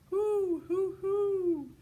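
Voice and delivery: high, loud owl calls; loud owl hoot. A narrator's reading. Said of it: "and they were shouting".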